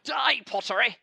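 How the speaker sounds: loud, high mock war cry; mock battle cry. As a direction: yell it like attacking a foe.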